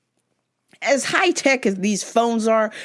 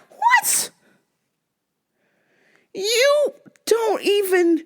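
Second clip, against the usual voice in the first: high shocked "What?" into a shout; stunned outburst; 11 semitones higher, much slower than usual. Retold or imitated. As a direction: blurt it out in total disbelief. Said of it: "And I'm like".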